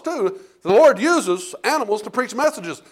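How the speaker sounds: ordinary delivery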